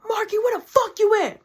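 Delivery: high, loud call through the house; mock yell. Mom hunting for her son, calling out in a high mock yell. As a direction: call out for the hiding son, hammed up.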